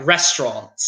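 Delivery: "Restaurant" as RES-tront; two-syllable "Restaurant", low in pitch; swallowed letters. Shown as a demonstration. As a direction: drop the middle vowel of "Restaurant". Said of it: "swallowing some of the words".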